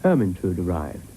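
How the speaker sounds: ordinary delivery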